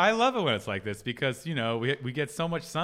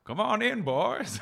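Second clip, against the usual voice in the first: put-on higher, hammy old prospector voice; gruff mountain man; 8 semitones higher, slower than usual. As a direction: call the boys in like an old prospector. Old prospector welcoming boys.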